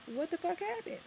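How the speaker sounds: bewildered question. Real, not acted. Vlogger asking viewers about Harlem, high bewildered question. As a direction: ask it high and stunned.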